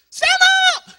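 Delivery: sudden high, full-force shout; shouted command. Real, not acted. Preacher orders the congregation to their feet, shouted command. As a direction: yell it out as an order.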